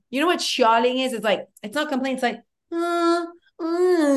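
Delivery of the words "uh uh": drawn-out, high whiny groan; whining moan. Shown as a demonstration. Friend showing what the word means, high whine.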